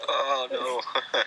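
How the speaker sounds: ordinary delivery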